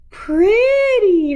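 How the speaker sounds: little sisters squealing with joy; excited squeal. A narrator's reading. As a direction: squeal with delight. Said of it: "her little sisters squealed excitedly".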